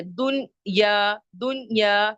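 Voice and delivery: दुनिया split slowly into dun-yaa; दुनिया slowly enunciated with a long ending; pronunciation demo. Shown as a demonstration. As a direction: stretch the final aa of दुनिया, teacher sounding it out. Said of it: "खड़ी जबर की sound आ रही है".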